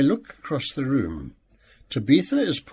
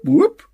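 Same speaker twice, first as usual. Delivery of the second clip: alarm whoop; 7 dB louder than usual. A narrator's reading. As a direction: whoop sliding way up, much higher and louder. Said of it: "a high, rising sound like a fire alarm".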